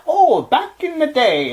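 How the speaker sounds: high, slow put-on storyteller voice; drawn-out mock wise narrator tone. Friend teasing his host's myth-telling, in a high slow mock voice. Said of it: "like, you do, like".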